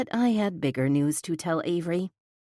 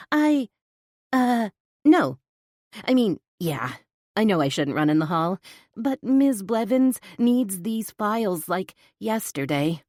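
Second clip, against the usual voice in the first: flustered; 5 semitones higher, 4 dB louder than usual. Acted. Flustered stammering reply to boss.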